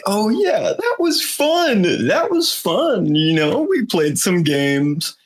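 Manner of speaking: squeaky nervous [name] pretending it's fine; mock [name]. Retelling a TikTok [name] parody, high falsetto. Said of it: "as [name], he's like".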